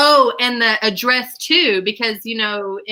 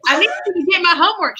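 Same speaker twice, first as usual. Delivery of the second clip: frantic demand; 8 semitones higher, much faster than usual. Retold or imitated. Daughter begging mom to get her homework back, fast and high. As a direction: rush the plea in a panic. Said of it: "I was like".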